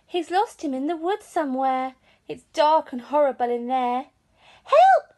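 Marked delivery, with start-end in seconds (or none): 4.7-5.1 high, loud cry for help; sudden high-pitched panicked shout; frightened child's cry. A narrator's reading. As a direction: yelp it out like a scared little boy. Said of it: "said Eddie".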